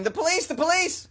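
high squawking parrot cry; shrill bird alarm call. Retold or imitated. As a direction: screech it like a parrot. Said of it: "he started shouting".

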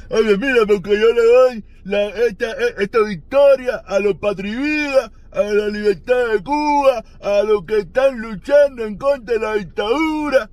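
high, slow, loud mocking imitation of the boxer's speech; mock victory speech. Mocking the boxer's imagined victory speech, high and slow. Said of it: "se va a salir".

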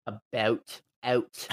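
low Canadian "about", "out"; Canadian accent. Shown as a demonstration. Showing friends how they say about and out, Canadian accent. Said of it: "I say about and out".

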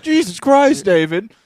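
put-on loud, high shout at a child; hammed-up outraged scolding; mock exasperation. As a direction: play the horrified parent. Voicing adults scolding David.

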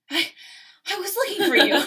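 high, loud, hammed-up breathless line; halting start, then exaggerated breathless burst; mock panting. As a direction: pretend to be winded and overdo it. Redoing the panted line, laughing.

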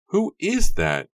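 high, loud, slow alarmed shout; an onlooker cries out in alarm; alarmed cry. A narrator's reading. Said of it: "Alarmed voices rang out from the crowd".

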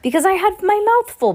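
put-on high squeaky boy voice, mouth full; Bruno's voice. A narrator's reading. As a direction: pipe it up like a greedy little boy.

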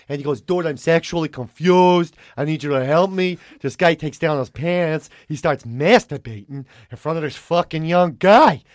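put-on slow, emphatic New York accent; intense New Yorker. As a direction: imitate an intense New York guy telling a wild story. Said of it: "he's very intense".

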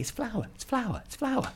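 very high pitched pleading; frantic high repetition; desperate insisting. Retold or imitated. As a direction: beg them to believe it. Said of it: "we kept saying".

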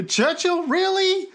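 very high questioning disbelief; incredulous. Acted. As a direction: query it as if it's absurd. Incredulous at Churchill being named.

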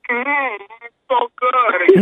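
put-on food bliss, stretched slow; hammed-up yummy hum; savoring mock moan. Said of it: "You don't have to go".

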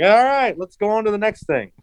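high, forced brightness; change the subject in a nervous high voice; hammed-up panicky brush-off; mock-casual deflection.

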